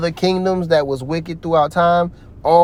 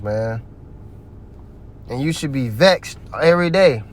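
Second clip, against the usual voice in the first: normal talking voice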